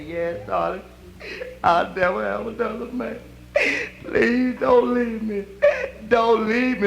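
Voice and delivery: slow crying plea, a touch hammed-up; mock tearful plea. Wife at the deathbed begging her husband, mock sobbing. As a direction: beg him not to go, sobbing lightly.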